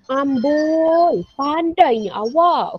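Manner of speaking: kagum. Shown as a demonstration. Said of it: "hairan atau kagum".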